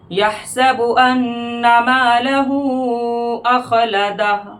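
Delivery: slow, sung tajweed recitation; Quran recitation. Shown as a demonstration. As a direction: chant the verse with full tajweed melody. Recites verse melodically.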